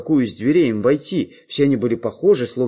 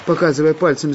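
usual speaking voice

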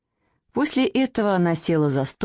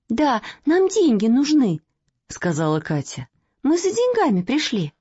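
lightly voiced little girl; 6 semitones higher than usual. Katya's child voice.

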